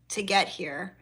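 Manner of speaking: usual speaking voice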